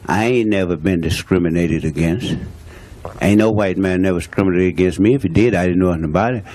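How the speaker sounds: normal talking voice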